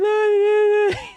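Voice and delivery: high, drawn-out imitation of a freaking-out kid; mock toddler wail. Toddler freaking out on first flight, mimicked wail. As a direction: wail like a panicking child. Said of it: "the kid is freaking out".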